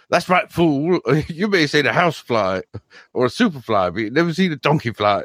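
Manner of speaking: high, cocky mock Donkey. Quoting Donkey's favourite line in a high Donkey voice. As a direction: do Donkey bragging as he flies. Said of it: "and he goes".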